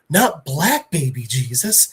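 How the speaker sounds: ordinary delivery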